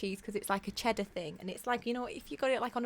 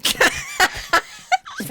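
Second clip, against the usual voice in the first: laughing; 8 dB louder than usual. Real, not acted. Joking about past self.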